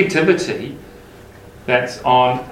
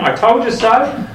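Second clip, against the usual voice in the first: told you so, mock Thomas; 8 semitones higher than usual. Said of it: "I imagine Thomas would have been saying".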